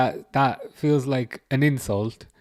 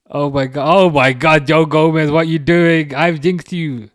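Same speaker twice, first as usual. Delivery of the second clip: louder, rising cry of disbelief; exasperated shout; 4 semitones higher, 7 dB louder than usual. Real, not acted. Fan yells at Joe Gomez after his blunder. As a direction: shout at the player on screen.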